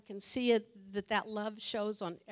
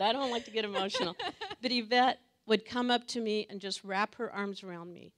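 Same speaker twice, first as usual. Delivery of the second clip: ordinary talk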